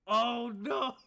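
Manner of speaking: high, loud drawn-out wail of dismay; dismayed outcry. Real, not acted. Game master sees the fire spin go wrong, loud dismayed outcry.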